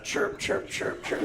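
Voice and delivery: high, whispered chirping bird call; mock bird chirp. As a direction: chirp like a cheap nature recording. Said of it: "Makes his stupid bird noise".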